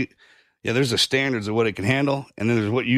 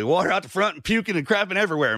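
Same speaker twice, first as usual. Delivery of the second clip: ordinary delivery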